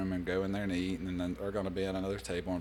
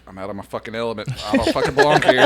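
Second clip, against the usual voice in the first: laughing panic; 2 semitones higher, 12 dB louder, faster than usual. Retold or imitated. Sailor telling friends about the fancy lunch, laughing panic. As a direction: lose it laughing at your own panic. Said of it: "I was like".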